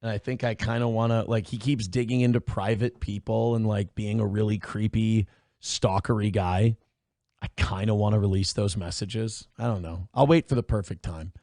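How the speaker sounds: low, quiet, conspiratorial; quiet scheming aside; mischievous hush. Real, not acted. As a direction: drop your voice like you're plotting something. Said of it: "I'm feeling a little mischievous".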